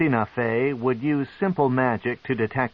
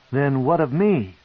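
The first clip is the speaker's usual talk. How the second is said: anxious cry; 5 semitones higher, 4 dB louder than usual. A narrator's reading. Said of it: "he cried".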